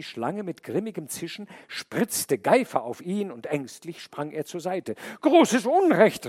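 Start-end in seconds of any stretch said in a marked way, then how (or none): 5.2-6.3 high, loud, slow outcry; man cries out at the snake; cry out. Acted. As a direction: shout it in outrage. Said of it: "rief er".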